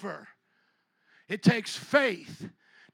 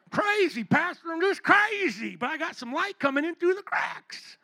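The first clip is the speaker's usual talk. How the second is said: higher, whiny mock member; mock church member; 4 semitones higher than usual. Pastor mocking a member talking to him, higher. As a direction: play a self-pitying churchgoer. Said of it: "hear Christians talk like this".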